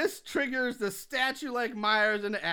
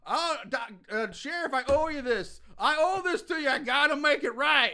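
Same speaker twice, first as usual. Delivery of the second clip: higher, louder, swelling mock heroics; hammed-up hero; 7 semitones higher, 4 dB louder than usual. Podcaster retelling Loomis's speech to the sheriff, hammed-up heroics. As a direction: play it as a showy noble sacrifice. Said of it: "Loomis like".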